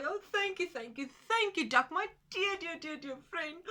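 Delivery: high, loud joyful thanks; tortoise overjoyed, shouting thanks. Acted. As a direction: gush with joy at the duck. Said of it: "shouting for joy".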